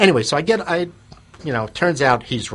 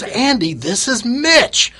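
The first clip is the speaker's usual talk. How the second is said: high, slow, thrilled greeting; an old college friend shouting hello in delight; delighted recognition; 12 semitones higher, 5 dB louder, much slower than usual. Retold or imitated. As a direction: stretch out the name of the old friend joyfully. Old friend recognizes him on phone.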